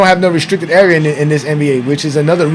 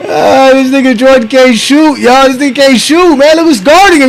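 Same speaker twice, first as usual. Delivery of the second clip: excited mocking; 6 semitones higher, 4 dB louder than usual. Real, not acted. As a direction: crow over the miss. Mocking Jordan while laughing.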